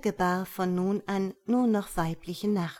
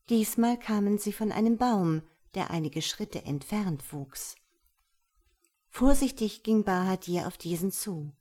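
ordinary delivery, nothing marked

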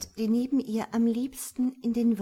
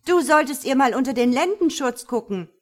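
loud, higher, quick outburst; bursting out; 5 semitones higher, 11 dB louder, faster than usual. A narrator's reading. Samaela blurts out a jibe about the daughter, bursting out. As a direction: blurt it out, barely held in. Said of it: "Samaela prustet heraus".

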